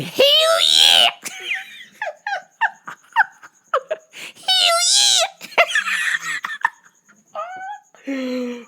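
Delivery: a shouted hell yeah with a laugh; celebrating the takeoff; cheer. Retold or imitated.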